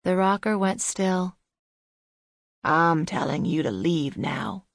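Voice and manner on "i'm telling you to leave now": low, controlled order to go; stern dismissal. A narrator's reading. Old man ordering the visitor out, low and stern. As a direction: send them away in a low, gruff old voice.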